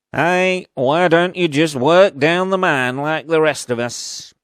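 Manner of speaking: hammed-up Northern accent. Film parent telling the lad he'll never do it, hammed-up Northern accent. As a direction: bellow it as a disapproving Northern parent. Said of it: "Northern accent you've, uh, ever heard".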